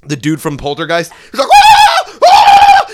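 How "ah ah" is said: extremely high, loud mock screams; hammed-up horror-film screaming; weird priest shrieks. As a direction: shriek like a possessed-movie priest. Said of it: "making all these fucking weird noises".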